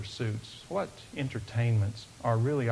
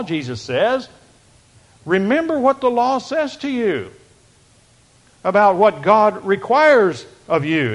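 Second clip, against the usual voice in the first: loud, raised preaching to the congregation; fervent preaching; 6 semitones higher, 9 dB louder than usual. Acted. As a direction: proclaim it, rising and louder. Preaching at full force.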